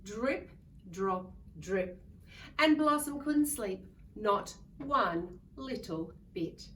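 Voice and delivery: ordinary delivery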